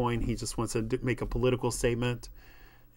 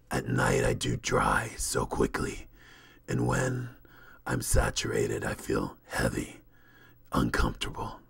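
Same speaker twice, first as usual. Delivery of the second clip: low rumbling supernatural being; brooding creature; 13 semitones lower than usual. Acted. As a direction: speak from the depths, heavy and halting.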